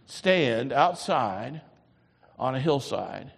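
ordinary delivery

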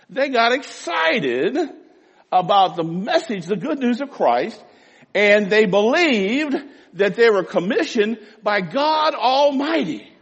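impassioned pulpit proclamation; fervent preaching. Real, not acted. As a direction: build with conviction to a ringing finish. Preacher exalts the early disciples' zeal.

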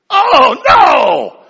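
loud high mock cry of despair; mock wail. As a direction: wail it like a cornered husband. Mock despair at wife's question.

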